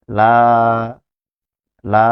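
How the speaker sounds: লা held long, repeated; teacher stretching লা; vowel drill. Shown as a demonstration. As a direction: draw out the আ in লা. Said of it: "উচ্চারণ করব লা".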